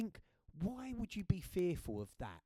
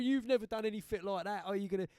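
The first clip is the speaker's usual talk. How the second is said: mocking friend; 6 semitones higher, 6 dB louder than usual. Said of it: "he just took the piss out of me".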